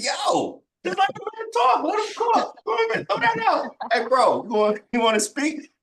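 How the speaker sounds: high, fast hype shouting at a friend; mock-excited crowd yelling at a friend; hyped-up shouting. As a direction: yell it like you're egging your bro on. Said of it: "And we're like".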